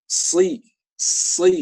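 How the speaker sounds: Si with a heavy shad; shad sound. Shown as a demonstration. Teacher demonstrating the shad letter. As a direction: press si from the back of the mouth. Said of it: "pakainya shad ya".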